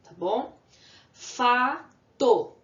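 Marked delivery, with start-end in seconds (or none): 1.1-2.5 Fato high and loud, Fa, then to; syllable by syllable. Shown as a demonstration. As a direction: punch a long Fa, pause, then to. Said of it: "Eu abro a boca duas vezes".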